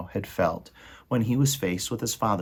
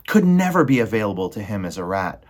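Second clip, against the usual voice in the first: ordinary delivery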